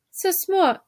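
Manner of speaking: high, haughty French retort; French mouse mother. A narrator's reading. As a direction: toss it off indignantly in French.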